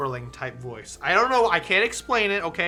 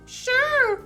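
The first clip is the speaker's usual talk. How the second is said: high character voice; 4 dB louder than usual. Acted. Voicing a character.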